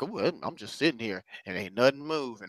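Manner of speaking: lazily smug, mocking the idle. Preacher mimicking a complacent person, mock smug.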